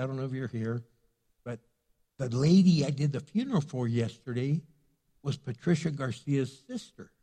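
usual speaking voice

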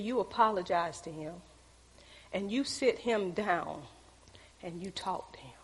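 low, hushed voice of God; quietly instruct, fading almost to a whisper; retold gentle instruction. God's quiet instruction to her.

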